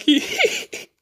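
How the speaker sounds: high-pitched, giggling through the words; laughing. Real, not acted. Telling viewers about an angry prank victim, cracking up. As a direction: barely get it out for laughing.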